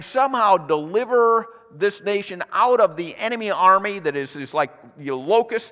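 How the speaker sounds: exasperated objection. Acted. Gideon objects to his calling.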